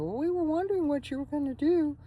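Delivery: a caricature of her family asking; mock family voice. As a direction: mimic the relatives' pinched question. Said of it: "And my family said".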